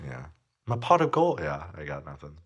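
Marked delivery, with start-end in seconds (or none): 0.7-1.4 high, louder Irish leprechaun voice; Irish leprechaun accent. Pretend. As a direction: play a cheeky Irish leprechaun. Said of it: "That was your Irish accent".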